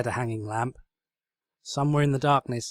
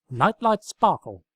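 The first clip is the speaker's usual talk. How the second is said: grand announcement; 6 semitones higher, 7 dB louder, slower than usual. Acted. Twilight names the lamp.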